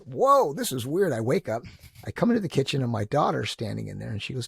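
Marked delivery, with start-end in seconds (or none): 0.0-1.1 surprised whoa. Retold or imitated. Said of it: "I'm like".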